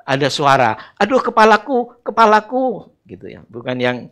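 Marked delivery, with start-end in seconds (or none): 1.0-3.0 put-on high, loud cry of a sick boy; high child's cry of pain. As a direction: wail like a child with a headache.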